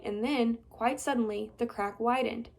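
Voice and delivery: plain reading voice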